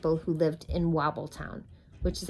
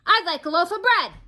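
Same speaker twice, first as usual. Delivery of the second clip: high, loud booming demand; 12 semitones higher, 9 dB louder than usual. Acted. Mister Noisy orders bread from the baker's wife, booming. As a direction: boom it across the shop. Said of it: "boomed Mister Noisy".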